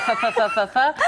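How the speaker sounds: lisped Spain-Spanish syllables; mock Castilian lisp. Comedian mocking Spanish from Spain, lisped Castilian babble. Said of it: "Spanish from Spain".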